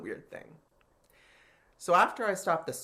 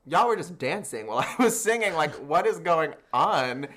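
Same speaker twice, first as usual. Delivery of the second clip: indignant; 4 semitones higher, 8 dB louder than usual. Retold or imitated. Indignant at judges' harsh critique.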